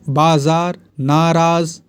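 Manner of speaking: usual speaking voice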